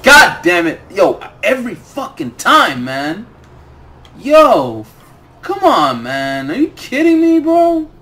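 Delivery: yelling at the screen, voice cracking high; furious shout. Real, not acted. Gamer yelling at Bungie's loot over repeat arms, furious shout. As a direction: blow up at the game over another repeat drop.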